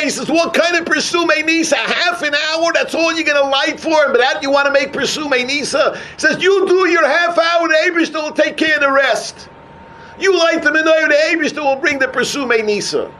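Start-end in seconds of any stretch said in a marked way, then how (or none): 0.3-6.0 high-pitched rhetorical questioning; retold incredulous challenge. Preacher quoting a rabbi's question, incredulous challenge. 6.4-13.1 high, forceful preaching answer; emphatic exhortation. Retold or imitated. Preacher quoting the rabbi's answer, emphatic exhortation.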